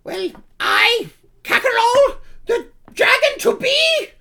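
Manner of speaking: pompous, very high kobold proclamation; Kakarol. Acted. Self-styled dragon demanding an offering, high pompous voice. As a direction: announce yourself grandly in a squeaky creature voice, with pauses.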